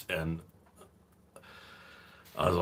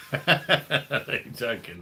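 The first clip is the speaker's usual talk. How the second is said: high burst of laughter; laughing; 8 semitones higher, much slower than usual. Real, not acted. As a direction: laugh through the words. Laughs at his own joke.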